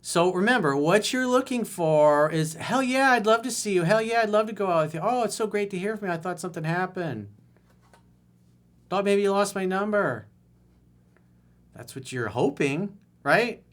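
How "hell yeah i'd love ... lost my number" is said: high, fast, gushing enthusiasm; mock eager reply. Coach acting out the ideal excited reply, high and gushing. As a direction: play the thrilled girlfriend, hammed up.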